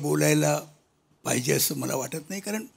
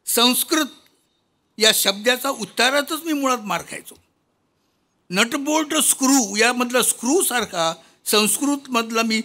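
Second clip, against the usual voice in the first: higher, emphatic rhymed verse; poem recitation; 5 semitones higher, 4 dB louder than usual. Acted. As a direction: declaim comic verse to the hall. (विनोदी कविता वाचन, चढ्या आवाजात)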